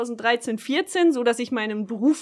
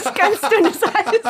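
slow, higher, through laughter; laughing, drawn out; 9 semitones higher, 5 dB louder, much slower than usual. Acted. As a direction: stretch it out while laughing. Repeats warning, drawn out and laughing.

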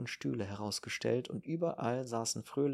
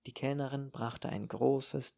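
usual speaking voice

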